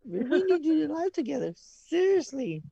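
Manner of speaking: high, loud and eager; excited. Real, not acted. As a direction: pitch it up with excitement. Excited echo of the live idea.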